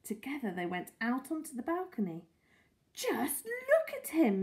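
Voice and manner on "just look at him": high, slow, proud exclamation; gushing, drawn-out pride. A narrator's reading. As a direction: show off a beloved pet. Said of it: "Missus Silver said proudly".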